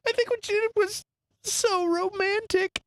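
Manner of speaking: high mock-weepy wife, slowing on the last words; imitating a tearfully smitten wife; crying wife. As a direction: draw out the romantic gush in a high voice. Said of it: "she starts crying".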